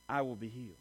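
low, hushed conviction; quiet certainty. Retold or imitated. Woman trusting Jesus will heal her, quiet certainty. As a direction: drop right down, soft and sure.

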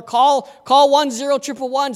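urgent call to the next room; mock yell. Deacon yelling to his wife while running out, mild mock yell. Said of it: "he yells to his wife".

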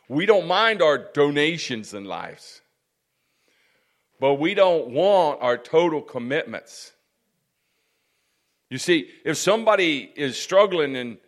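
loud, emphatic sermon delivery; forceful preaching. Real, not acted. Preacher challenging listeners on donations versus total commitment, forceful preaching. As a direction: drive the point home to the congregation, loudly.

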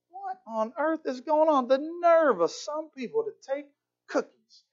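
high, acted-out indignation; indignant disbelief. Woman watching him grab another cookie, indignant. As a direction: imitate her appalled inner protest.

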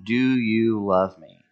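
third asking, slow and deliberate; slow, searching repeat of the question; slow, weighted question. Retold or imitated. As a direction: draw out each word of the question. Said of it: "Third time he asks him".

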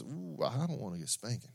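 put-on a small scared child dreading a spanking. Preacher voicing a child afraid of Dad, softly.

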